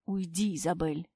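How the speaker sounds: low, soft hiss in her ear. A narrator's reading. Husband hisses into heroine's ear. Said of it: "прошипел он мне на ухо".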